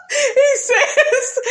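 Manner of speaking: high laughing voice. Real, not acted. Vlogger laughing while retelling Marceau's line, high. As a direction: giggle through the setup.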